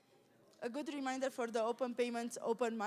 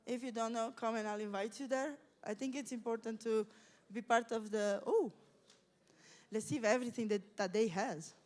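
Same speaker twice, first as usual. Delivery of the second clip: ordinary talk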